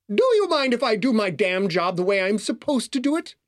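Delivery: high, huffy protest; offended doorman standing on his duty; indignant butler. A narrator's reading. Butler indignant at being rushed.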